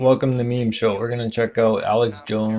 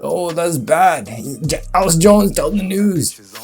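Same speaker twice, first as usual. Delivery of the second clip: high, loud, hammed-up horror; mock alarm; 6 semitones higher, 4 dB louder than usual. Host mocking Jones presenting news, high loud mock alarm. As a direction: act scandalized by it.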